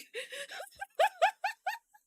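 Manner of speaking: shrieking high laughter; high loud laugh. Real, not acted. Laughing at the mom story.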